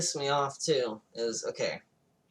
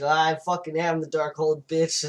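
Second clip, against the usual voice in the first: louder, higher villain comeback; mock boast; 4 semitones higher, 7 dB louder than usual. Villain answers the hero's Darkhold boast, mock defiance. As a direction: top the rival with a hammed-up retort.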